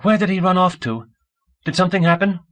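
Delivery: high, urgent anxious questions. A narrator's reading. Micah over the intercom after the kid ran off, anxiously. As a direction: ask in a high, worried voice. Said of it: "Micah inquired anxiously over the intercom".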